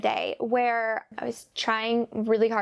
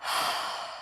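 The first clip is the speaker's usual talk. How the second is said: weary sigh; 6 dB quieter than usual. Retold or imitated. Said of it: "my first feeling is just".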